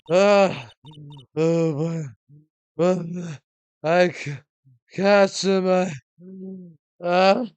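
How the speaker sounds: panting crone, winded from the chase; huffing old woman. Acted. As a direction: gasp it out like a winded old lady. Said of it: "that huffing and puffing".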